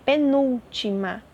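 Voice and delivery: pe-NÚL-ti-ma, leaning on "núl"; stress on antepenultimate syllable. Shown as a demonstration. Teacher repeating the example, "núl" of "penúltima" stressed. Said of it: "antepenúltima sílaba, que é a mais forte".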